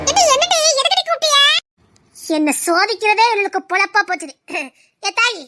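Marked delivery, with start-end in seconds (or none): 0.1-1.4 loud, high irritated reply; annoyed shout back. Acted. Girl yelling back at the old woman calling her. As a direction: snap back at the caller.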